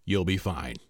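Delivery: low, soft, emotionless brush-off; dry deadpan. Retold or imitated. Actress dismissing his panic again, low soft deadpan. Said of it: "And she just looks at me again and says".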